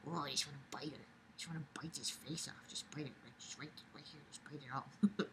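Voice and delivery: very soft mock-menacing baby talk; playful whisper. Speaker doting on a cutie, playfully threatening in whisper. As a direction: whisper it with playful cute aggression. Gushing she could bite the cutie.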